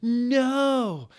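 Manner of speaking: very high, loud cry of no; a high, loud, stretched wail; yelled. Acted. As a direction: shriek it as the bullet hits. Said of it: "yielded everyone".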